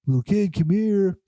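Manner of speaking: high coaxing call, imitating someone summoning a dog. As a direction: call the kid over like a puppy. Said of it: "calling him like a dog".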